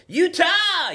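very high, loud holler; full-voiced heckling shout; yelled out. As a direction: yell it across the bar like an obnoxious fan. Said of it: "I'm the asshole that yells out".

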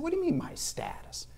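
high, soft, mock-baffled query; hushed, high retold bafflement; puzzled question. Acting his puzzled question to friend.

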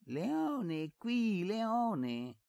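very high, exaggerated copy of a child's call; mocking imitation. A narrator's reading. Servant boy aping Efisio's call to the dog, mocking. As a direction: parrot the boy's call in squeaky mockery. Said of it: "imitò Basilio".

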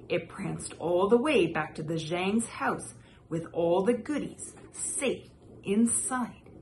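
ordinary delivery, nothing marked

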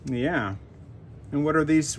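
usual speaking voice

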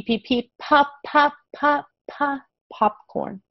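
high, bouncy pops slowing into popcorn; popcorn P sounds. Shown as a demonstration. Teacher linking P to popping popcorn. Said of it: "So P".

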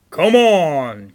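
loud booming goat dare; bold call. A narrator's reading. Great Big Billy dares the troll, loud call. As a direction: call out the challenge at full volume. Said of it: "called Great Big Billy".

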